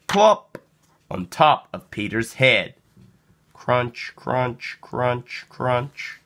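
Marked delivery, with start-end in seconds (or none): none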